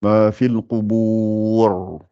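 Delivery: Ma fil qubur recited very slowly, the final ra of qubur round and heavy; full ra recitation. Shown as a demonstration. Teacher showing the full ra at a stop, slow chant. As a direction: chant it slowly and swell the last ra. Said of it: "sera gonflé".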